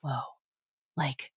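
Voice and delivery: soft slow whisper. Retold or imitated. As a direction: hush it like gossip. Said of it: "they were like".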